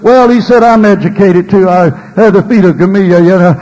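loud mock boasting; braggadocious Paul. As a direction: brag loudly, imitating a proud apostle. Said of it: "Paul would find himself being braggadocious".

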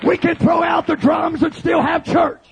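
impassioned, shouted revival sermon; full-force preaching. Acted. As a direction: preach it hard to a shouting congregation.

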